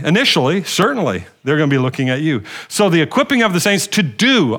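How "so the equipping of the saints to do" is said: "do" leaned on at the end; stress on "do". Real, not acted. Preacher driving home the saints' part to the congregation, stress on "do". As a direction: land firmly on the word "do". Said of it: "I just wanna emphasize that word do".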